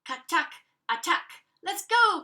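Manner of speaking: loud, high rallying shout; rally everyone to charge; excited battle cry. Acted. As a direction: Selma crying out to scare the dog. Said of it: "cried Selma".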